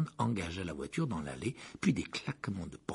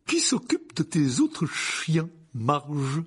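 jovial uncle; 6 semitones higher, 6 dB louder, much slower than usual. Acted. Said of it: "demanda l'oncle Vernon".

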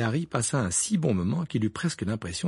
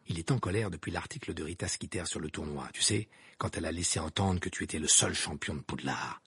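softer, hushed explanation; low voice; 5 semitones lower, 6 dB quieter than usual. A narrator's reading. Cédric explaining to Harry, still hushed. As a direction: keep it low so his father won't hear.

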